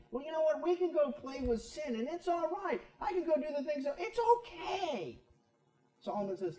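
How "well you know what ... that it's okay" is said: high, carefree voice of a man fooling himself; airy and smug, pitched well up; careless sinner. Retold or imitated.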